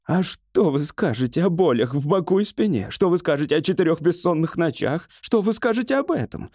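high, insistent string of challenges; triumphant outcry. A narrator's reading. Patient challenges doctor about his pains, triumphant outcry. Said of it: "с триумфом вскричал Бедекер".

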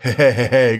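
low he-he chuckle, mimicked; put-on drunken snicker; imitated drunken laugh. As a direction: mimic a tipsy man's chuckle. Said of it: "cười xỉn mọi người".

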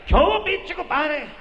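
high, loud jovial voice; cheery compadre. Acted. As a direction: boom like a happy drinking buddy. Compadre greets loudly.

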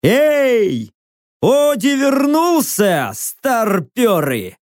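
high, drawn-out hail to everyone around; hollering. A narrator's reading. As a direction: call out slowly across a great distance. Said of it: "заорал на всю сраную лечебницу".

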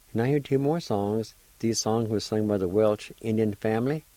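loud, upbeat radio host introducing songs; radio announcer. Acted. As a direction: sell the songs to listeners.

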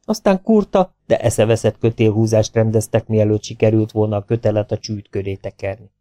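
usual speaking voice

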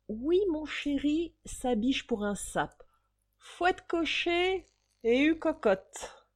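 plus haut, lent et traînant, aguicheur; voix de fille aguicheuse. Acted. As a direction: joue la fille qui héle le fiacre. Cocher apostrophé par la fille. (voix aguicheuse, haute et traînante)

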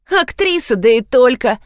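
plain reading voice

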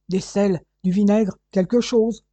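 gruff, urgent barked list; rough, hurried grumble; grumbling. A narrator's reading. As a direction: grumble the orders impatiently. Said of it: "grommela-t-il".

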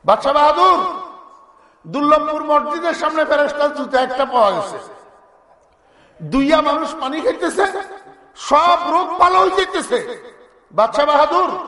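high, excited report to the king; king's toady. Acted. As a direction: announce marvellous news to your ruler. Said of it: "চামচা একটা যাইয়া এই খবর পৌঁছাইছে".

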